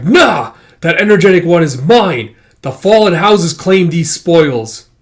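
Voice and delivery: high, forceful villainous declaration; loud claim. Acted. Fallen house hunter claiming Baby Micah as spoils, loud declaration. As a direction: seize the prize with your voice.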